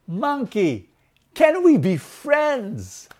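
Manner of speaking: high, drawn-out appeal; elephant's plea. Acted. Elephant asks the monkey for friendship, high pleading call. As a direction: call up sweetly and stretch the question out. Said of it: "And the elephant said".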